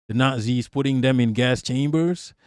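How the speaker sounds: ordinary talk, nothing marked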